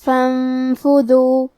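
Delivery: "فانفذو" very slowly, ن held as a nasal hum; ghunnah recitation. Shown as a demonstration. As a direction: chant "فانفذو", hiding the noon in a long hum. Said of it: "یہ حکم کو غنہ کے".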